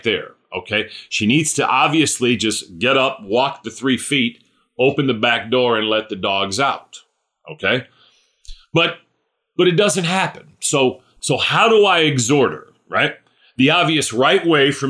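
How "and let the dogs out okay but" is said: ordinary delivery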